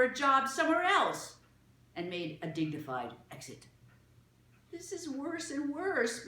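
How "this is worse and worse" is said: slow, high moaning lament. A narrator's reading. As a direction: drag it out like a moan of despair.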